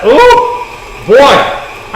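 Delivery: thrilled high exclamation over the frosting. Real, not acted. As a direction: squeal with delight at the mix. Mixer sugar coming together.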